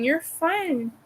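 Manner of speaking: ordinary talk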